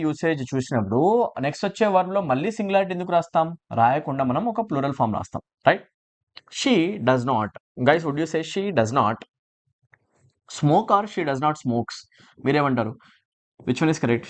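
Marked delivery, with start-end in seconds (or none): none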